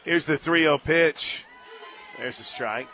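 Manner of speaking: ordinary talk, nothing marked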